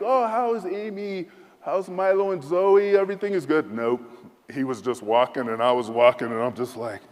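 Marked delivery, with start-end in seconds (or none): 0.0-3.7 friendly chit-chat. Retold or imitated. Usual friendly chat imagined.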